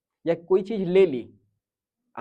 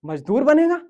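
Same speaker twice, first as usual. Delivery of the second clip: loud, higher scolding shout; parent scolding; 7 semitones higher, 9 dB louder than usual. Retold or imitated. Parents scolding the son who wants a truck, loud. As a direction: bark it down at the boy. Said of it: "डांट देते हैं".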